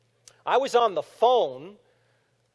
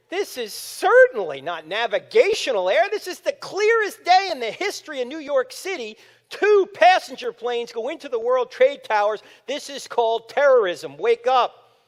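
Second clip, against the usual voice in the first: louder, higher angry lecture to the TV; indignant rant; 7 semitones higher, 8 dB louder than usual. Retold or imitated. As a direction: tell the commentator off, loud. Ranting back at the commentator.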